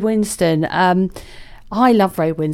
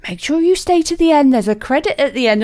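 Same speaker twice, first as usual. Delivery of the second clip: higher, louder, chirpy usher imitation; host imitating the cinema usher's cheerful reminder, higher and louder; nice cinema lady; 6 semitones higher, 3 dB louder than usual. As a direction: mimic the helpful lady at the cinema. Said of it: "as the nice lady in the cinema told us".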